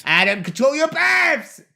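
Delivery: high, drawn-out exasperated yell; scolding shout. Real, not acted. Director scolding the actor for burping, shouting. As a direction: yell it in comic outrage.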